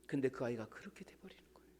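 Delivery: whispered, almost no voice; hushed whisper. Real, not acted. Preacher whispering to the congregation about the lost child. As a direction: breathe it out like a sad secret. Sad aside after the scolding.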